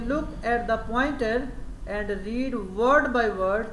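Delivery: very slow, word-by-word classroom instruction; slow teacher model. Shown as a demonstration. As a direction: lay out each word for the pupils. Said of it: "پھر آپ کہیں گے". (بہت آہستہ، لفظ بہ لفظ ہدایت)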